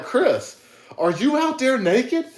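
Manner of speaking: mock neighbor Rusty, high pitched. Rusty on the phone calling out to Chris, high drawn-out mock voice. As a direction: squeak it out like a startled neighbor. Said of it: "He'd be like".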